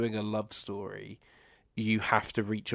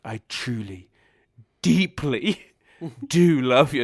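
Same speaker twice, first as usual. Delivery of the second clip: slow, louder over-sincere mock love line; mock earnest declaration; 3 semitones higher, 10 dB louder than usual. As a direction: ham up the tender declaration. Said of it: "She's then like".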